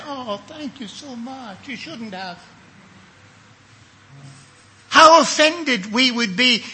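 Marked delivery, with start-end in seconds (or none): 0.0-2.4 soft, drawn-out mock gratitude; gerührter Dank. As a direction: gush it quietly, higher than usual. Acts out receiving a present.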